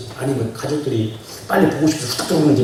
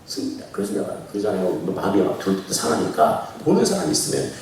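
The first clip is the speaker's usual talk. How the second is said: ordinary talk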